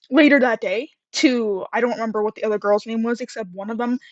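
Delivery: ordinary talk, nothing marked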